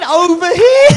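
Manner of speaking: high, loud mock echo of over here; mocking imitation. Comedian mocking the audience member's phrase, high and loud. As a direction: throw his words back in a high mocking voice. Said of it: "Man said".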